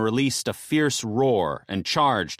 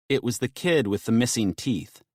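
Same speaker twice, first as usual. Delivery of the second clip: plain reading voice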